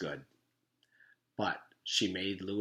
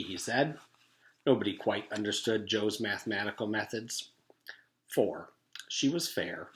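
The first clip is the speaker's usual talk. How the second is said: ordinary delivery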